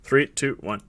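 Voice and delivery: ordinary delivery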